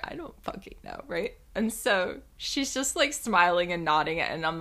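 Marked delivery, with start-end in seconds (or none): none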